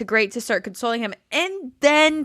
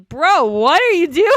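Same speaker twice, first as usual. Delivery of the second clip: louder, higher incredulous cry at the screen; exasperated call-out; 6 semitones higher, 5 dB louder than usual. Retold or imitated. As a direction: throw it at the TV in disbelief. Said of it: "I'm like".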